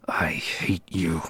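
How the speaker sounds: slow, breathy whispered I hate you; exasperated whisper. Acted. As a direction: hiss it out, fed up with him. Bickering with a rival.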